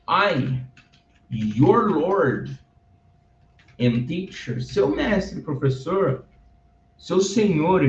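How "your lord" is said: ordinary talk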